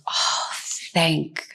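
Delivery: slow, soft relief, recalled; relieved sigh. Retold or imitated. Recalling first discovering heartfulness, softly relieved. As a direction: let it out slowly, like remembered relief. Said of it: "it felt like".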